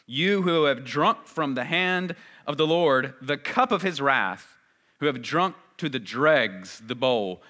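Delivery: loud, declaiming Isaiah; scripture reading. Acted. Preacher reading Isaiah to congregation, loud proclaiming. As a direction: proclaim the prophet's call to Jerusalem. Reads Isaiah aloud with force.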